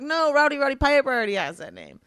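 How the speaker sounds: high, loud mock protest; retold objection. Retold objection to friends' idea.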